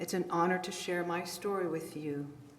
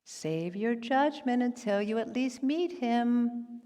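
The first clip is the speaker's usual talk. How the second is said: slow, higher-pitched imitation of the daughter; daughter's reproach, drawn out and higher; quoted daughter; 5 semitones higher, slower than usual. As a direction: mimic the child handing back a parent's lesson. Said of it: "your own child throws back the same words".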